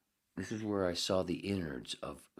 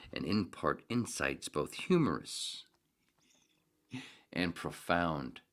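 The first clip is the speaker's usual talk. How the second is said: slow, louder, grandiose reading; dramatic reading; 7 dB louder, slower than usual. Acted. Reader hamming up the essay, slow grand proclamation.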